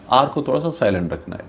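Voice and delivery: normal talking voice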